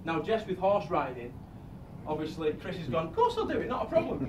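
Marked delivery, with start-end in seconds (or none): 3.1-4.3 high, keen imitation of the groom; chirpy "sure thing" reply; eager yes-man voice. Groom quoted, eager agreement.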